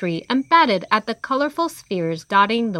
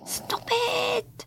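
character voice; 7 dB quieter than usual. Acted. Character protests in put-on voice.

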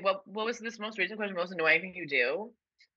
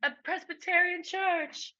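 mock lovestruck; 9 semitones higher than usual. Mock romance ending.

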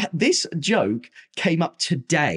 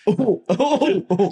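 high laughing oh oh; teasing laugh; 10 semitones higher, 4 dB louder, slower than usual. Real, not acted. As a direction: tease with a laughing oh. Teasing wine price slip.

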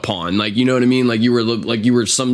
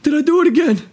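imitating Danny; 4 dB louder, faster than usual. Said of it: "he's like".

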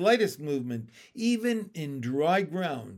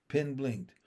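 ordinary delivery

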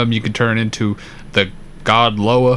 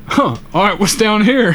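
higher, curious retold musing; curious past self; 8 semitones higher than usual. Retelling finding the pipe to his friend, lightly curious and higher. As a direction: pitch up and wonder aloud what's below. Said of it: "and I was like".

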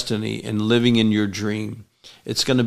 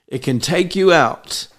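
ordinary delivery

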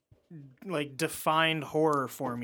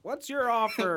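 put-on grumpy old man; 7 semitones higher than usual. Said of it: "Eustace is just like".

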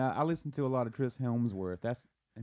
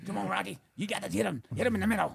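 higher, urging corner-man voice; Rocky's trainer; 8 semitones higher, faster than usual. Acted. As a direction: push the fighter from his corner.

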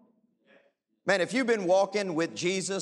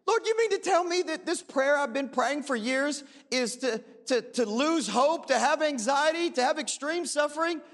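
incredulous prayer; 7 semitones higher than usual. Retold or imitated. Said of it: "I'm like".

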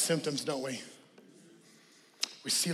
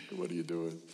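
mock puzzled onlooker; 12 semitones lower, 11 dB quieter than usual. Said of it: "repeated imitation".

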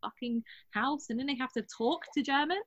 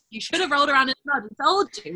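mock scolding; 2 semitones higher, 10 dB louder, faster than usual.

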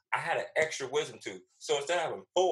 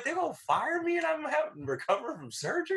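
high-pitched, hammed-up indignation; outraged disbelief. Patient learns of firing while recovering from surgery, outraged. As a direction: protest in high, exaggerated disbelief. Retold outrage at being fired.

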